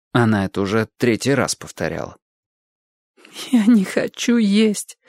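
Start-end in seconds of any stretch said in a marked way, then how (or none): 3.5-5.0 high, slow, strained through tears; choked voice. A narrator's reading. Girl answering her sister at breakfast, choked. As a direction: squeeze the words out past a lump in the throat. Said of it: "сдавленным голосом отозвалась Эллисон".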